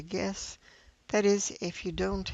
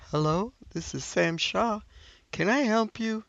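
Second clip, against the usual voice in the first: a lightly voiced older father; a hint of an older man picking up the phone; older man's voice; 2 semitones higher than usual. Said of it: "I hear an older man's voice".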